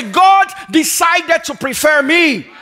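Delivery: slow, high, loud, shouted declaration; call for the congregation. Shown as a demonstration. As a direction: lead the crowd in a strong declaration. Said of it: "Everybody say".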